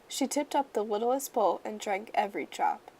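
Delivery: plain reading voice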